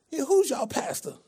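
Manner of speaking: put-on old church lady voice. Prank caller asking restaurant about church, old lady voice. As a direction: ask sweetly like an elderly churchgoer.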